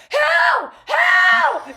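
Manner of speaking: high excited shrieks of Who; a high excited yell from the audience; screaming fans. Retold or imitated. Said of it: "Girls are just screaming".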